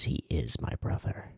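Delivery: soft unvoiced whisper. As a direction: hush it like a secret. Repeats the quote in whisper.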